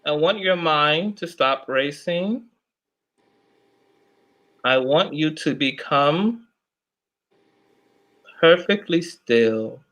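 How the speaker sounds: soft echoing dream voice. Acted. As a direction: lull them into a trance. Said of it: "can you please help me nickname this voice".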